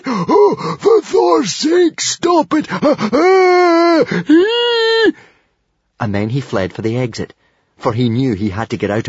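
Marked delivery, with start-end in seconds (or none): 0.0-5.1 very high, loud shrieks between laughs; helpless laughter, slow drawn-out cries; hysterical roar. A narrator's reading. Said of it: "Roared the hairy, scary librarian".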